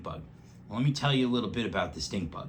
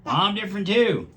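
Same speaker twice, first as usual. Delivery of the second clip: high, loud excited dog voice; the dog adding his quirk; Mister Paws the dog; 12 semitones higher, 8 dB louder than usual. A narrator's reading. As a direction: bark it out happily. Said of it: "said Mister Paws".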